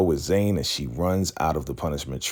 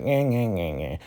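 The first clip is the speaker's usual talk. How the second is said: mocking whine; 3 semitones higher than usual. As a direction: make a childish mimicking noise, pitched up. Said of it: "mocking Zane because".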